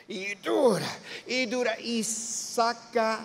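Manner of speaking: slow, drawn-out effort on each word; mock straining. Preacher acting Peter hauling the net, slow mock straining. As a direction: act out heaving a heavy load.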